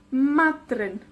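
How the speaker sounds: Madryn with MA stretched and stressed; MA-dryn, first syllable strong. Shown as a demonstration. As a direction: hit MA in Madryn, drop on dryn. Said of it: "la penúltima con la énfasis".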